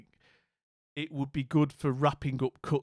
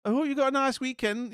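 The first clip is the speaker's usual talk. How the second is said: much higher, chirpy Scottish auntie voice; mock Scottish welcome, pitched way up; Scottish accent; 10 semitones higher, 5 dB louder than usual. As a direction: gush over Becca like a Scottish auntie. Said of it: "doing the accent".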